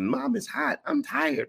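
put-on high whining child; whiny kid. Kids complaining on the stadium stairs, high whine. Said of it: "the kids are complaining".

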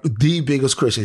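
ordinary delivery

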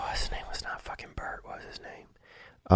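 muttered whisper while searching memory; whispering to himself. Real, not acted. Recalling a neighborhood guy's name, whispering to himself. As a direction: hush it under your breath as you try to remember.

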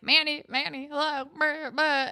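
mock attention-seeking. JT trying to reach distracted Manny, mock pestering.

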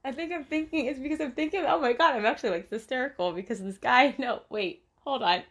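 can't stop laughing while apologising; nervous laughter breaking up the words. Real, not acted. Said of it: "I don't know why I'm laughing".

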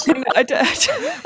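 high laughing denial. Real, not acted. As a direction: laugh while saying no. Laughs at race war joke.

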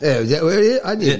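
comic mock-Cockney accent; put-on Cockney accent. Guest explains his voice to the host, put-on Cockney accent. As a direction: talk in a hammed-up Cockney accent. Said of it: "it's a little bit Cockney".

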